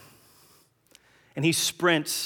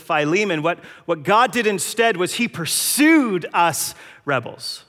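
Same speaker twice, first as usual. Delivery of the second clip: preaching at full force; 4 semitones higher, 7 dB louder than usual. Acted. Preacher to his church, building to full-force preaching. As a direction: drive the point home to the congregation.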